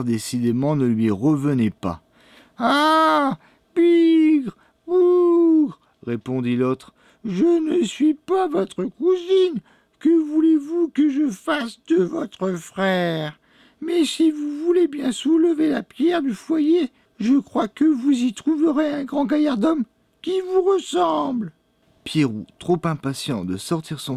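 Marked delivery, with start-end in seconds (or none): 2.6-5.8 piercingly high, louder crone voice; witch's exclamation. A narrator's reading. Old woman answers the boy's question, high witch's exclamation. 7.2-21.5 put-on old witch. A narrator's reading. Old woman lures the boy to lift the hearthstone, high witch voice.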